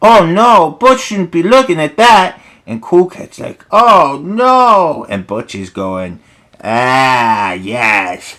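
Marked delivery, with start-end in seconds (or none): 0.0-2.4 higher, louder put-on dismay; alarmed little friend. Cool Cat's friend seeing the bully misbehave, high mock alarm. 3.7-5.1 high cartoon wail of dismay; Cool Cat. Cool Cat shocked by what the bully watches, high mock dismay. 6.6-8.4 lightly voiced gloating bully; Butch. The bully loving what he watches, mildly gloating relish.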